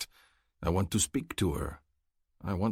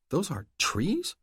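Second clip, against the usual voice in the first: incredulous question; 10 semitones higher, slower than usual. A narrator's reading. Female character disbelieving.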